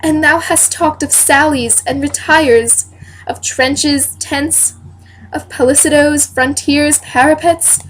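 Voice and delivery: theatrical Shakespearean questioning of a husband; stage-read monologue with lilting emphasis; Lady Percy. Acted. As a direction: question your lord earnestly, in measured verse, swelling on the battle cry. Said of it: "I am reading as Lady Percy".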